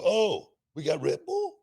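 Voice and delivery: high, slow mock-delighted "we got Red Bull"; a wrestler's surprised glee, hammed up; imitating a coworker. Host mimics a locker room guy finding Red Bull, mock delight. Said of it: "somebody see the Red Bull and go".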